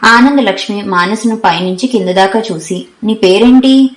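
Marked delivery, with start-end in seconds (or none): 3.0-3.9 higher, louder, a commanding demand; like an officer questioning. A narrator's reading. Anandalakshmi sizing up her new roommate, officious. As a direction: ask her name like an official interrogating. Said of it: "ఏదో అధికారి అడిగినట్లు".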